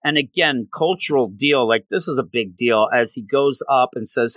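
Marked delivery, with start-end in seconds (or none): none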